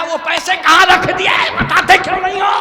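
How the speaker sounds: high, loud mock demand; demanding son. Preacher mimics son questioning dying father, loud high demand. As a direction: shout it like a pestering son. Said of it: "और लड़का बगल में खड़ा, खड़ा कह रहा".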